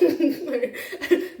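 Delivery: bright rising laugh; short laugh. Real, not acted. Vlogger laughing at her own tangent, short bright laugh. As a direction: laugh at yourself.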